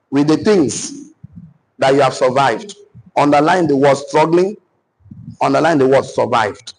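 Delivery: deliberate dictation with long pauses; punctuated, phrase-by-phrase teacher's dictation. Acted. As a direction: read it out piece by piece for writing down.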